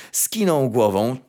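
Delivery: ordinary delivery, nothing marked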